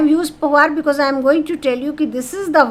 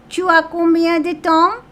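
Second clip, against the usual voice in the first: "Tu as combien de temps" held high, rising at the end; raised questioning tune on "Tu as combien de temps"; intonation question; 5 semitones higher, 4 dB louder than usual. Shown as a demonstration. Teacher shows conversational French questioning, intonation question on "Tu as combien de temps". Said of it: "familiar intonation form of questioning".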